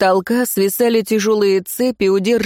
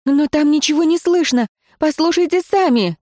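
frightened plea; 9 semitones higher than usual. Acted. Heroine pleading on the floor.

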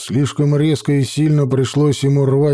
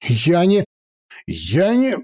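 sputtering, higher with fury; choking with rage; 4 semitones higher than usual. A narrator's reading. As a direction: choke on your own anger. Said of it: "аж поперхнулся от ярости".

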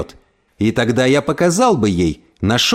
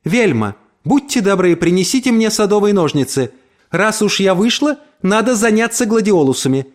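high-pitched, determinedly chipper request; brisk, cheerful voice; 8 semitones higher than usual. A narrator's reading. Mother asks the maid for shears within neighbours' earshot, brisk cheer. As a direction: speak up brightly, for the neighbours to hear. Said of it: "заговорила громким, бодрым голосом".

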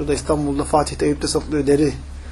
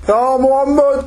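a Bedouin's raised call, higher pitched; mock yell; 6 semitones higher, much slower than usual. Bedouin shouting for the Prophet, mock call. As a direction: call out across the desert camp. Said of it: "Peygamber'e yüksek sesle bağırdı".

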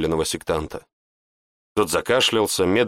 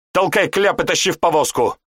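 higher, raised urgent command; urgent order; 8 semitones higher, 3 dB louder than usual. A narrator's reading. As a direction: hurry the soldier. Vernar orders soldier.

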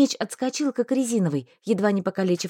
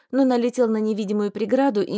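usual speaking voice

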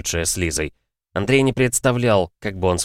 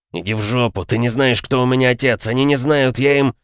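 higher, louder furious threats; angry young man; 5 semitones higher, 3 dB louder than usual. Acted. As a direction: spit out threats in a rage. Vlad raging again.

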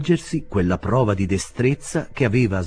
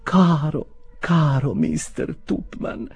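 high, slow, cooing falsetto; lovesick tenderness; 9 semitones higher, much slower than usual. A narrator's reading. Spinster aunt over the dozing Tupman, lovesick cooing. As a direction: croon the name adoringly. Aunt confesses affection to sleeping man.

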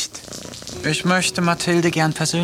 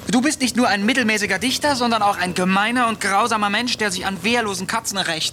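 higher, fast, outraged cat voice; indignant cat; 9 semitones higher than usual. Acted. Woken cat berates the poet for pulling whiskers, higher and fast. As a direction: spit the insults out in a rush.

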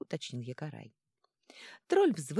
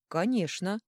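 firm, louder, higher assent; crisply emphatic; 4 semitones higher, 6 dB louder, slower than usual. A narrator's reading. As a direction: answer at once, decisively. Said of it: "в один голос отчеканили". (чётко, отчеканивая)

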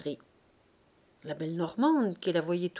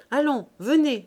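raised-voice call to a customer; 5 semitones higher, 8 dB louder than usual. Acted. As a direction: call her back, higher and louder. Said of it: "lui cria la poissonnière".

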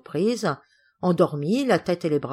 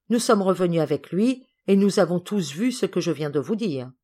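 usual speaking voice

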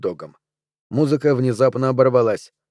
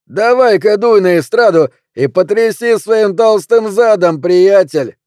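loud, high-pitched jeer; rowdy high holler; heckler's shout; 7 semitones higher, 7 dB louder, slower than usual. A narrator's reading. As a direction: egg him on from across the bar. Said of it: "крикнул кто-то".

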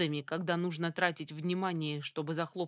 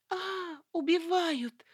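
high panicked scream; громкий крик; 11 semitones higher, 3 dB louder than usual. A narrator's reading. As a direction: scream it out in terror. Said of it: "громко заорала Люсьен". (истошный вопль)

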